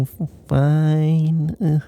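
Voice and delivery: ordinary delivery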